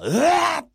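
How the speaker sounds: sharp high scream of surprise; startled cry. Acted. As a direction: cry out as the sword falls. Serizawa cries out under attack.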